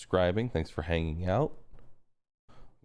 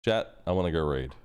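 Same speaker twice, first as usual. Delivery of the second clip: ordinary talk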